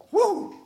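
high-pitched "woo"; exultant little whoop; thrilled whoop. Real, not acted. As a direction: let out a short, high whoop of joy. Preacher thrilled at being God's son.

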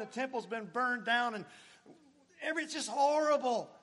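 high, loud anguished preaching; loud pulpit lament. Acted. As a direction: cry out the ruin of it all. Preacher's loud lament over exile.

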